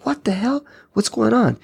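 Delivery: mock bewilderment at something strange; retold alarm. Retelling his alarm at flashing lights.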